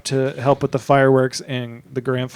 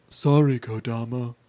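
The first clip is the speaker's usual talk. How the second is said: monotone voice; 3 semitones lower, 4 dB quieter than usual. Retold or imitated. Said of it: "talks in the most monotone voice".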